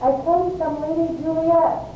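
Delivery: very high, carrying call; high-pitched hail from outside. Acted. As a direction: call through a closed door. Nurse calls from outside the door.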